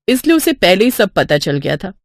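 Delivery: plain reading voice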